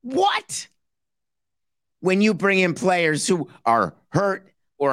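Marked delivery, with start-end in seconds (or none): 0.0-0.7 squeaky high What; a hammed-up gasp of disbelief; startled yelp, very high; mock shock.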